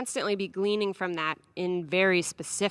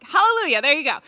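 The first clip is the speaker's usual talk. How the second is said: high, loud joyous cry of relief; exultant hallelujah; 6 semitones higher, 13 dB louder, faster than usual. Retold or imitated. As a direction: celebrate like a prayer answered. Joyful relief at agreement.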